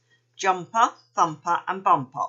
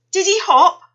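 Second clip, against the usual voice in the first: high, loud eager question; excitedly; 12 semitones higher, 11 dB louder than usual. A narrator's reading. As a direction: blurt it out full of hope. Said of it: "asked Missus Gray excitedly".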